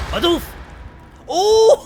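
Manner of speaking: loud high yelp then laughter; yelp, then burst out laughing. Real, not acted. Hit in game, laughs.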